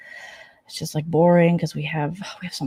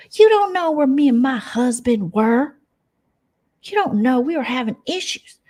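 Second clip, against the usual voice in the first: high, whiny back-and-forth bickering; mock high catfight; 8 semitones higher than usual. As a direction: play both sides of the spat high. Acted quarrel, two women.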